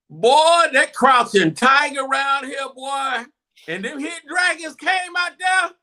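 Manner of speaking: loud and high, hyping the win; hyped up. Real, not acted. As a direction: shout it out high with glee.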